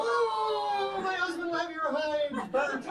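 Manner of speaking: very high, squealing put-on waitress; hammed-up high-pitched waitress reply. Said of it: "They go".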